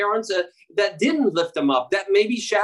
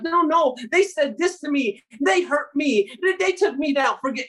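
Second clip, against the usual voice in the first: higher, whiny self-pity; mock complaining; 6 semitones higher than usual. Believers clinging to past hurts, higher mock whine. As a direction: whine about old hurts like a wounded child. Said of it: "We're like".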